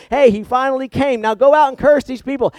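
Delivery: the king crowing his orders; king greets Balaam in high, loud excitement; excited king. Acted. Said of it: "is all excited".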